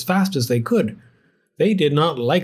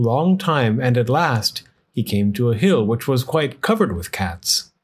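plain reading voice

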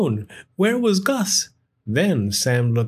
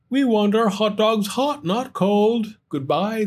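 higher, put-out grumble; fed-up customers; complaining customers; 9 semitones higher than usual. Acted. Hot dog customers complaining.

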